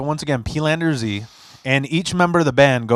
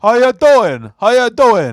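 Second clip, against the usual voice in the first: loud, high hammed-up Chicago greeting; Chicagoan; 9 semitones higher, 8 dB louder, slower than usual. Friend demonstrating Chicagoan to merch girl, loud mock accent. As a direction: boom out a caricatured Chicago accent. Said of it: "how to be a Chicagoan".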